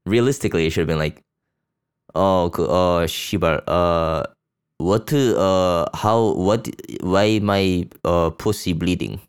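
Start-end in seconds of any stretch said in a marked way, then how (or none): 2.2-9.2 exaggerated Korean accent impression, flustered; mock Korean accent. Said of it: "that's the accurate accent".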